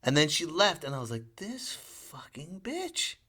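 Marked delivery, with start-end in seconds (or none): 1.4-3.1 slow, seething inner curse under the breath; thought, not said aloud; internal curse. Retold or imitated. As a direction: let it drip out slowly, higher and hushed. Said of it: "I didn't say that. Internally".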